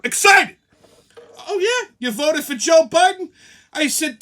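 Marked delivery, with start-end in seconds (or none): 0.0-0.6 high, loud disbelieving echo; loud indignant echo; exasperated excited. Real, not acted. 1.5-3.3 high, loud mock surprise; sarcastic disbelief. Sarcastic retort to the woman.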